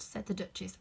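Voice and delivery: ordinary delivery, nothing marked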